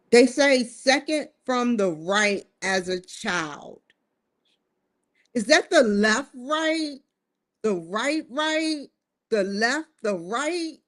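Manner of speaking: ordinary talk, nothing marked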